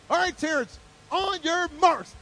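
acted brother starting a race; race starter. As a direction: call the runner to the line.

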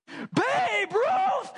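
very high, shouted gasp of disbelief; shocked realization. Retold or imitated. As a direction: shriek the name in horror. Kids realize whose ball.

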